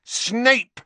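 the name blurted high and loud; furious boy yelling the name; loud outburst. A narrator's reading. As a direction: explode with the name. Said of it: "he said very loudly".

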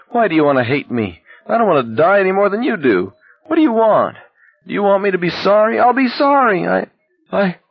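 very high, pleading whine; whining. A narrator's reading. Barkovich pleading with Garrity for his life, whining. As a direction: whine frantically, pitch climbing as you beg. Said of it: "Barkovich was whining".

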